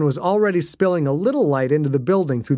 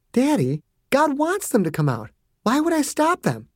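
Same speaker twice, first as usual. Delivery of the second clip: high, light and puzzled; a girl sweetly reasoning; innocent child voice; 10 semitones higher than usual. A narrator's reading.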